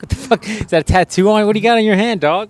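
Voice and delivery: high, startled outburst; surprised. Real, not acted. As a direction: jump up in pitch at the surprise. Spots friend's new ring.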